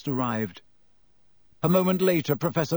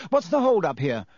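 a pompous prefect, pitched higher, pushing through; head boy voice, higher and a bit louder; self-important; 7 semitones higher, 3 dB louder than usual. A narrator's reading. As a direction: clear the way like you own the place. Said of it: "bustling importantly".

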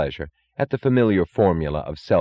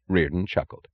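usual speaking voice

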